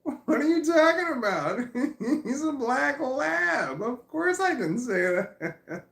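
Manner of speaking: high, loud, slow laughing reply; laughing protest. Retold or imitated. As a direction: defend yourself through laughter. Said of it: "I'm just like".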